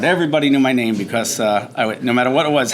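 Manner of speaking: ordinary talk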